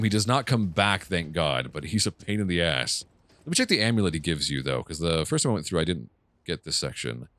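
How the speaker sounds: ordinary delivery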